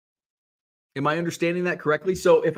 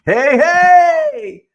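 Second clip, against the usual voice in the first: high, loud broadcaster's "Hey, hey!"; home run call; 12 dB louder than usual. Retold or imitated. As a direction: belt it like an excited play-by-play man. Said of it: "I say his home run turn call all the time".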